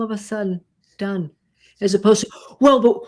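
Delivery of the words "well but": defensive retort. Pretend. Defensive reply example.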